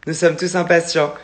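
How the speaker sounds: ordinary talk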